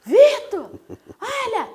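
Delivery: very high, loud hammed-up squeal; mock overwhelmed wailing. Paraders to reporter and friend Vitor, mock tearful squealing. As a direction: imitate a gushing fan shrieking through fake tears. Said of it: "eu tô chorando".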